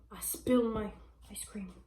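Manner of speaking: whispered aside to herself; a whispered oops over spilled ice cream. Real, not acted. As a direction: drop to a whisper, mid-mishap. Aside while eating ice cream.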